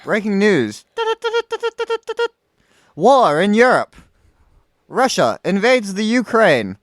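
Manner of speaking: loud, high mock news bulletin; big dramatic newsflash voice; breaking news announcer. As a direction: punch out the headlines slowly like a news announcer. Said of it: "gonna have to mouth it".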